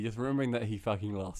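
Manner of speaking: ordinary delivery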